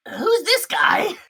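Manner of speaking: high, slow, wary barkeep voice; reserved bartender. Acted. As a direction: ask slowly and high, holding back. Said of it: "reserved, like".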